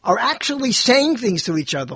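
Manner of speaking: ordinary talk, nothing marked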